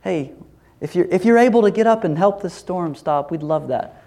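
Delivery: mock-polite, tentative little request; nice and gentle. Disciples asking Jesus to stop the storm, mock gentle. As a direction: ask ever so gently, as a parody. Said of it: "This isn't like a nice, gentle".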